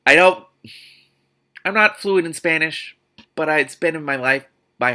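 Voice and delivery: high, loud indignant outburst; heated rant. Real, not acted. Reader, bothered by bad Spanish, protests loudly. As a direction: blurt it out indignantly. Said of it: "ranting about Spanish".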